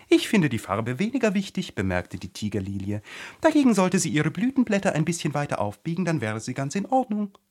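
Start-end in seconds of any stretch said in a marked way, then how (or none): 0.1-1.8 high, loud flower voice; pitched-up talking tiger lily; haughty talking flower. A narrator's reading. Tiger-lily gives her opinion. 3.4-7.4 high, quick flower voice; haughty high-voiced flower; haughty tiger lily. A narrator's reading.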